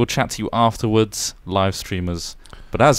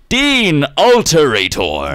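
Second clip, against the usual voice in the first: drawn-out announcement; 2 semitones higher, 4 dB louder, slower than usual. Acted. Announcing the producer's name.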